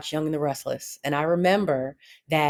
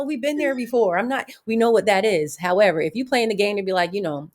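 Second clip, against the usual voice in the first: ordinary talk, nothing marked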